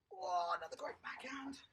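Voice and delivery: whispered praise, breathy and hushed; soft unvoiced hush. Real, not acted.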